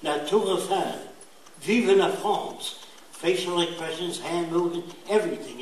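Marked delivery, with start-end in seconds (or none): 0.0-2.8 put-on French accent. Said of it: "When you were raised in France".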